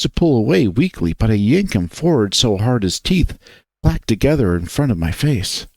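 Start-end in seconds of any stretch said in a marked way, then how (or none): none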